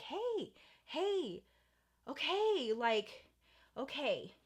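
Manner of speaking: put-on calm a crying girl down; softly soothing her; soothing hey. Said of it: "And I'm like".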